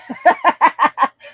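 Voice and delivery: short burst of real laughter. Real, not acted. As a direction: laugh it off, half annoyed. Laughs while getting mad.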